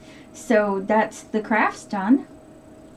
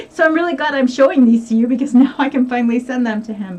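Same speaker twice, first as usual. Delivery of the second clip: laughing; 4 semitones higher, 7 dB louder, faster than usual. Real, not acted. Laughs while showing Hugo's shorts.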